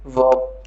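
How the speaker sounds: वर्ब said with the R silent; वर्ब with no R sounded before the final b. Shown as a demonstration. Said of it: "silent कर रखा है".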